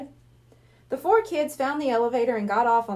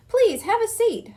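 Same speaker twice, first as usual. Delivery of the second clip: eager host; 10 semitones higher, 3 dB louder than usual. Acted. Hypnotist invites kids.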